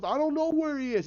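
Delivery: high-pitched mock panic; hammed-up desperate high voice; imitated terrified plea. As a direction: plead like a man dangling from a window. Dangled man pleads in terror.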